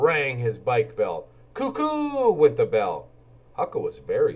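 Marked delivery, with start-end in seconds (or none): 1.5-3.1 high sing-song cuckoo; cuckoo call. Acted. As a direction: chirp it like a cuckoo clock. Bike bell makes cuckoo sound.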